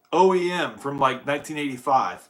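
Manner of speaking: ordinary delivery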